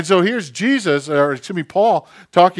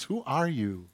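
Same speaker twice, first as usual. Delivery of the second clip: soft, measured questions with long pauses; quietly challenge, letting each question hang; lightly acted voice of God, hushed; God answering; 10 dB quieter than usual. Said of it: "And God answers".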